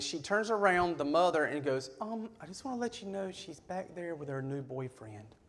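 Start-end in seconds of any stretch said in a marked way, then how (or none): 2.0-5.4 soft, confiding imitation of a mom; hushed awkward news. Mother warning daughter's ex about new boyfriend, hushed.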